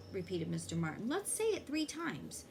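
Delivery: ordinary delivery, nothing marked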